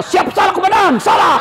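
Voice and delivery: high barked "siap salah komandan" like a scolded cop; mock shouted police salute; shouted police reply. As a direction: snap it out like a junior officer at attention.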